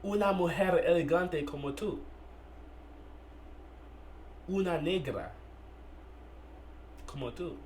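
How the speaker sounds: slow spoken-word delivery with long, weighty pauses; poem recitation. Acted. Poet addressing a woman about the man holding her, spoken-word recitation. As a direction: recite it phrase by phrase, letting each image hang.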